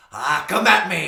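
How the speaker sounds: high, loud roared challenge; dwarf taunt. Acted. Dwarf daring the chimera after fire, loud shout. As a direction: bellow it at the monster. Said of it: "he's like".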